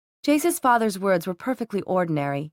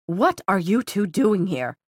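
slow, understated angry shout; restrained shout. A narrator's reading. Inquisitor to two intruders at the library door, mild slow shout. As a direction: draw out the outraged question, only mildly raised. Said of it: "she shouted".

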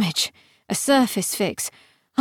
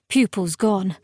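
plain reading voice

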